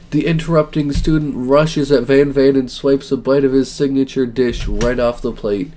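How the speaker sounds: normal talking voice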